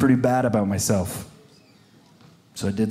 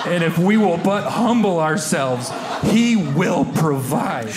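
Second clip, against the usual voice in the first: mock preaching; 5 semitones higher, slower than usual. Mock sermon about Burger King.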